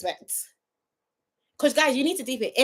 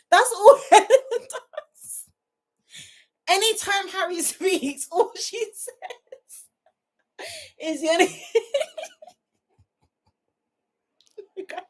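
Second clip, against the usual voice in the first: high squeaky words dissolving into laughter; crying with laughter; 8 semitones higher, slower than usual. Real, not acted. Reviewer failing to quote Helena, crying with laughter. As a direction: lose the sentence to helpless laughing. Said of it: "I'm actually crying".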